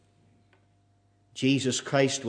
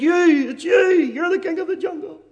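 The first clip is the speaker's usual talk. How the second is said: high, quick panicky monkey voice; frightened prey animal; 5 dB louder than usual. Acted. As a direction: blurt it out, terrified. Scared monkey answers lion.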